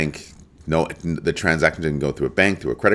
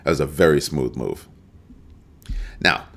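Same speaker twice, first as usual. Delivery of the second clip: ordinary delivery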